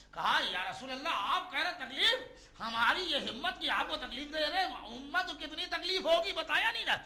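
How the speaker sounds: high, loud, mounting narration; impassioned preaching. Real, not acted. Preacher voicing the Prophet's worry for his community, high and impassioned.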